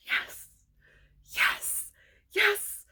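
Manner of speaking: breaking into a high-pitched yes; elated celebration. Real, not acted. Celebrating Jacob Elordi's nomination, elated. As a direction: savour each yes, separated by pauses.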